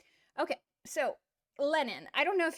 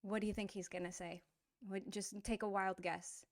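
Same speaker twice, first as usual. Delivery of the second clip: normal talking voice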